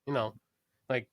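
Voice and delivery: ordinary talk, nothing marked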